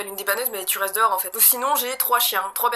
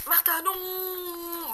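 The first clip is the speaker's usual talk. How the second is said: slow, drawn-out crying plea; desperate plea; 8 semitones higher, 5 dB quieter, much slower than usual. Acted. Declan begging Martha before the match drops, crying plea. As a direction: cry out to stop her.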